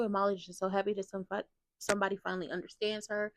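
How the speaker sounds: ordinary talk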